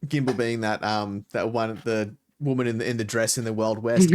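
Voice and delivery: usual speaking voice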